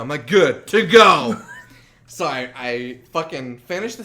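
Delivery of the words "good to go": slow, loud, emphatic retort; mock exasperation. Sandwich maker confirming it's to go, loudly exasperated. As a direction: hurl it back with hammed-up irritation. Acted curt reply to customer.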